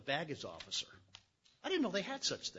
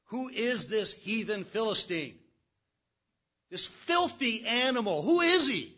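slow, louder, rising to a shout on "Who is he"; outraged challenge; 6 semitones higher, 10 dB louder, slower than usual. Acted. Young David furious at the giant defying God, shouting. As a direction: spit out the insult with righteous fury.

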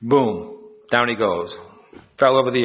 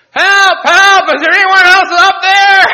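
very high, full-volume scream; desperate full-throated call for help; yelling for help; 11 dB louder than usual. Acted. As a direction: shout past God for another rescuer. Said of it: "he yelled like that".

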